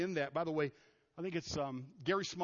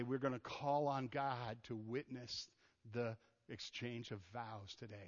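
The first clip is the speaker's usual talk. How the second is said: slow, hushed, much softer officiant voice; solemn wedding words; 5 semitones lower, 6 dB quieter, slower than usual. Retold or imitated. Pastor at a wedding, hushed and solemn. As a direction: intone it like a reverent vow. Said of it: "we stand up and we say".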